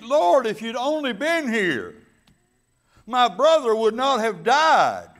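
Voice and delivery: loud, high, rolling preacher cadence; full-force preaching. Acted. Pastor preaching to his congregation, loud and fervent. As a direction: drive the scripture home to the congregation. Preacher retells Mary's plea loudly.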